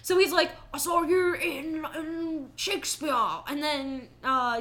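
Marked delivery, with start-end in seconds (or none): 0.7-3.1 slow mumbled imitation, "in Shakespeare" half swallowed; swallowed words. Mocking Macavity's line to Gus, "Shakespeare" poorly enunciated. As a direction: swallow "I saw you in" into a slurred mush. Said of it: "just a lot of swallowed words".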